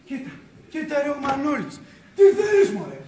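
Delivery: high, loud irritation rising to a shout; exasperated outburst. Acted. Boss catching Manolis hanging about, exasperated outburst. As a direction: build from disbelief to an angry yell.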